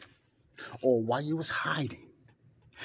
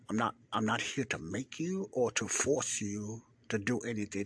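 ordinary talk, nothing marked

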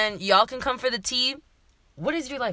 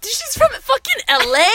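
high, loud disbelief; mock surprise; 8 dB louder than usual. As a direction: act amazed she's from LA. Mock surprise at friend's city.

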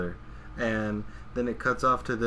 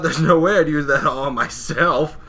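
indignant denial; 6 semitones higher, 11 dB louder than usual. Retold or imitated. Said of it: "And Takashin goes".

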